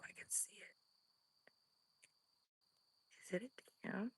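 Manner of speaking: breathless, voiceless whisper. Real, not acted. Spotting its face, frightened whisper. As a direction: hush it so the creature can't hear.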